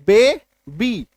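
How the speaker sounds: "बे, बी" spoken as two slow separate syllables. Shown as a demonstration. Teacher to students, splitting "बे, बी" slowly. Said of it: "दो बार में उच्चारण आ रहा है".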